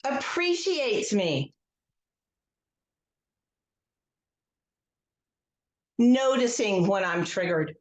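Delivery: slow guided script with long pauses; guided tapping script. Acted. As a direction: lead the group gently, line by line.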